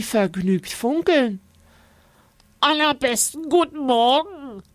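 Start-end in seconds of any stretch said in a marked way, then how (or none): 2.6-4.6 high, nasal creature voice; cheerful odd little creature; friendly creature voice. A narrator's reading. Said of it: "sagt das seltsame Wesen freundlich".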